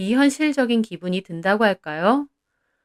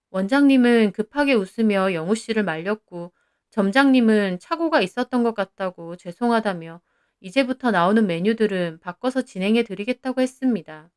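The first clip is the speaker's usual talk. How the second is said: plain reading voice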